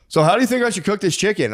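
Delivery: high-pitched imitation of a chatty customer. Market customer chatting to the chicken farmer, mocked in a high voice.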